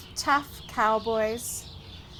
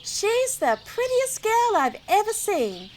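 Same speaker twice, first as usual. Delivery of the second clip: smitten thought; 7 semitones higher than usual. A narrator's reading. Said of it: "thought Will".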